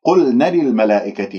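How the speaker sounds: "قلنا للملائكة" run together with a short na; "قلنا" ending in a short vowel before "للملائكة"; missing natural madd. Shown as a demonstration. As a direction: show the error: clip the long vowel of "قلنا". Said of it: "يعني نون مفتوحة".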